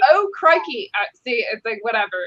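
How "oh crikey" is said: raised-pitch mock Aussie; Australian accent. Repeating the example impression for the hosts, exaggerated Australian accent. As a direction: play up a silly Australian twang. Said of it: "now I'm doing Australian".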